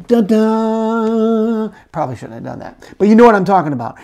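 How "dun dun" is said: higher sung dun dun hits; dramatic sting. Retold or imitated. Preacher doing the movie music sting for the video, sung hits. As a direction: sing the suspense sting for effect. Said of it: "'cause we're on video, it's like".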